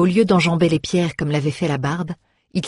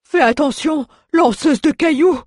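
very high dwarf character shout; crying out; 11 semitones higher, 4 dB louder than usual. A narrator's reading. Dwarf warns young woman before running, high-pitched cry. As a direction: squeal the warning out as the dwarf. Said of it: "cria-t-il".

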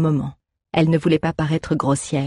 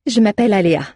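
proud and beaming; 5 semitones higher, 4 dB louder, faster than usual. Acted. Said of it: "dit-elle tout sourire".

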